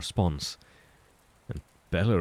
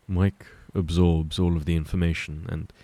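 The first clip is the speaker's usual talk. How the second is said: plain reading voice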